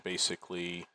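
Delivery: usual speaking voice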